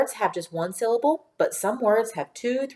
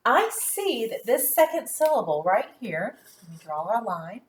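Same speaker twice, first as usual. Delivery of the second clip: usual speaking voice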